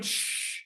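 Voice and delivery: soft, voiceless "shh"; shushing. Retold or imitated. People behind shushing dad, a soft hiss. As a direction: imitate an audience member's hush. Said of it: "the people behind are going".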